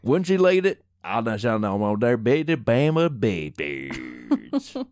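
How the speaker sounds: slow, hammed-up Alabama country accent; hammed-up Southern accent. Still teasing his co-host as a Bama Bigfoot witness, in a slow mock Southern accent. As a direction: stretch it out like a backwoods Alabama local. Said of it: "I don't know what kind of accent that was".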